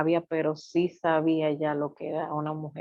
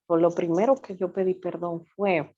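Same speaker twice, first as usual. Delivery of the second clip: usual speaking voice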